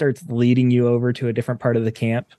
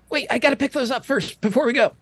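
high, fast panicky otter; squeaky otter; 11 semitones higher, faster than usual. Acted. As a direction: rush it, grabbing coins. Otter scrambling for coins.

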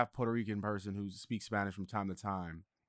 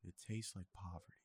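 much softer, repeating the punchline to himself; quiet amused echo; 4 semitones lower, 17 dB quieter than usual. Real, not acted. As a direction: murmur it back, savouring the joke. Echoing friend's punchline, amused.

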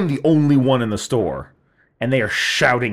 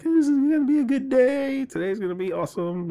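excited; 12 semitones higher than usual. Retold or imitated. Remembering his hopeful mood.

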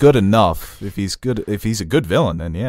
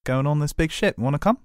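put-on New Zealand accent, higher pitched; Korg; 7 semitones higher than usual. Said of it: "It's Korg".

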